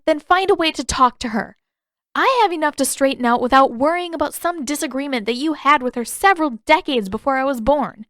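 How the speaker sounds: sarcastic, angry Katara. A narrator's reading. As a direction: dismiss him with sharp sarcasm.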